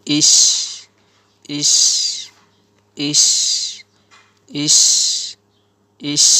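"ish" with a long, strong sh; "ish" repeated singly, with pauses; drilled "ish". Shown as a demonstration. Teacher shows alif zer shin jazm, drilling "ish". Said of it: "আলিফের জের শিনের জজম".

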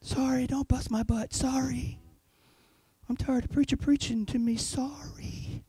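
mock half-hearted sorry, very soft; mumbled fake apology. Preacher mocking a shallow repenter, limp muttered sorry. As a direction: mumble it like you don't mean it. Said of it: "You're just saying".

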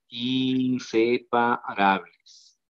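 inseparables stretched out very slowly; alargado, recalcado. Shown as a demonstration. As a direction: draw out every syllable of inseparables. Maestro recalca la palabra clave. (inseparables, muy lento, sílaba por sílaba)

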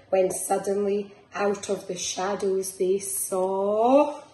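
lilting picture-book reading; reading to children. Acted. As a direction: bounce the rhymes along for little ones. Reads picture book aloud.

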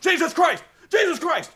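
high, loud frantic shout as the spooked dog; panicked dog yell. Retold or imitated. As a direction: yell in alarm as if the door just slammed. Dog voiced, door slams.

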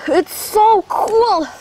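high, thrilled call from the water; excited calling out. Real, not acted. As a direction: call out to a friend, delighted.

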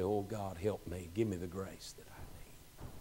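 soft, dropping to a whisper; quiet pleading prayer; hushed prayer. Retold or imitated. Praying quietly before God.

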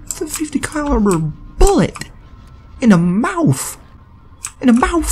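high-pitched, incredulous outburst; indignant protest. Real, not acted. Gamer complaining to whoever shot him in the game, high and indignant.

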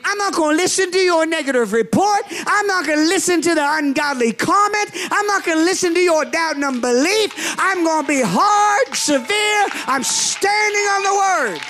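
full-force preaching. Acted. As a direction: preach it defiantly.

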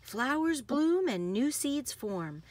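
lively, sing-song picture-book read; bouncy storybook narration with playful sound words; reading to children. Acted. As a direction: read it like bedtime story time for little kids. Reads picture book aloud.

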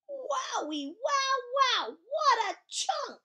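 high, drawn-out swooning wow; swoony, high-pitched amazement; awestruck admiration. Acted. Awestruck at the handsome boy.

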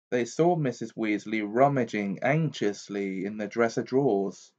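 ordinary delivery

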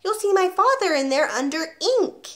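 put-on young pupil answering back; little boy. A narrator's reading. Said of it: "Eric said".

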